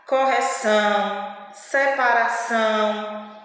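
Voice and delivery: "Correção, separação" slowly, with buzzing nasal endings; nasal vowel. Shown as a demonstration. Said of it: "a gente percebe esse vibrado no nosso nariz". ("Correção, separação" devagar, com final nasal)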